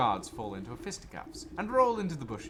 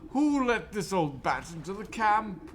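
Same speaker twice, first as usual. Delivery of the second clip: higher, louder outraged officer; indignant officer; 10 semitones higher, 7 dB louder than usual. Acted. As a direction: blurt it in outrage. Officer spots the granny.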